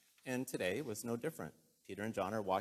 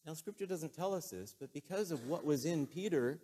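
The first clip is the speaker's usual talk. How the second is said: usual speaking voice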